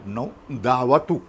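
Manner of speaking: slow, pointed दहावा तू; pointed reveal. Retold or imitated. Sage slowly revealing to the boy he is the tenth. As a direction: land the word तू as the punchline. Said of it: "तो म्हणाला".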